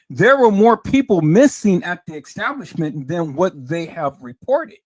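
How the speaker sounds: loud, forceful burst that eases off at the end; bubble-bursting announcement. Real, not acted. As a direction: hit MISSING hard and loud. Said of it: "let me bust your bubble".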